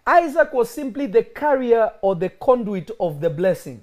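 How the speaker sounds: loud, fervent preaching. Acted. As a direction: build the sermon to a shout. Preaching builds to full force.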